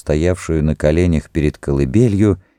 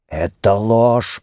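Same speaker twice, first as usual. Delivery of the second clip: slow, higher-pitched accusation; hissed denial; 4 semitones higher, much slower than usual. A narrator's reading. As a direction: hiss it through with restrained anger. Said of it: "прошипел верховный жрец". (медленно, с шипящей злобой)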